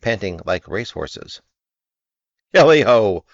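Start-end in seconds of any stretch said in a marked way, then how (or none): 2.5-3.2 loud merry whoop; jolly cry. A narrator's reading. Fezziwig calling the lads, loud jolly whoop. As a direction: whoop with delight. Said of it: "cried old Fezziwig".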